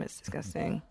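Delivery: hushed, breathy disgust; whispered disgust. Real, not acted. Friend tasting spicy drink.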